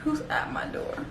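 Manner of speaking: whispered, acted-out question; soft, wary whisper to someone behind a door; hushed wary question. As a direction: ask it under your breath. Acts out wary answer to knocking.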